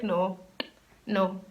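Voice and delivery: low, quiet no, spaced out; soft, low retold refusal; firm refusal. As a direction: refuse quietly, one word at a time. Retells her firm refusal.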